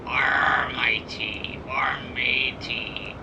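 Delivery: whispered pirate arr, matey; hushed mock pirate growl; old pirate man. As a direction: breathe it like a pirate. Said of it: "I sound like an old pirate man".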